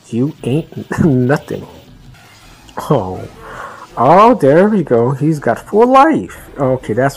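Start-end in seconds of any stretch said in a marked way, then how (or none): 4.0-6.5 surprised. Real, not acted. Boss suddenly refills health.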